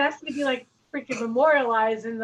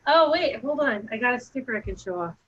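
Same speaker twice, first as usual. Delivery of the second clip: ordinary talk, nothing marked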